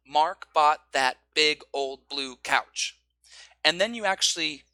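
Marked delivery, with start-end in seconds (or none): 0.1-3.0 slow, each word hit evenly; every word stressed. Shown as a demonstration. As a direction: give every word a beat.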